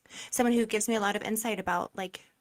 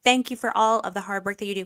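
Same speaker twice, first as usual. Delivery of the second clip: usual speaking voice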